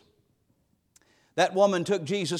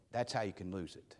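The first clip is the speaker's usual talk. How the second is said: dropped to a hush; 13 semitones lower, 18 dB quieter, faster than usual. Real, not acted. Quiet punchline to the congregation.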